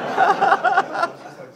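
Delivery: high, loud burst of laughter; laughing. Real, not acted. Telling the Clerks rocket story, laughing hard. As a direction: lose it laughing.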